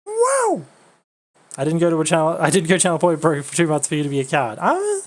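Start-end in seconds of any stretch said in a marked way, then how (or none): 0.1-0.6 excited. Real, not acted. Excited exclamation at game.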